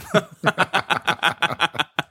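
high laugh after shouting. Real, not acted. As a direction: laugh at the outburst. Laughs after mock scream.

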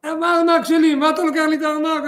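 high, loud outraged shout. Retold or imitated. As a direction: yell about the stolen wallet. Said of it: "אז הוא התחיל לצעוק".

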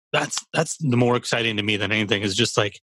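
ordinary talk, nothing marked

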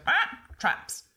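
high squawk, then the word; high-pitched mimic creature. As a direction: announce it like a parrot.

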